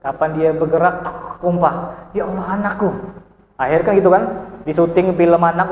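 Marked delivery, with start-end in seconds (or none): 2.1-2.9 soap opera mother. Retold or imitated. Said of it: "Tengoklah Indosiar".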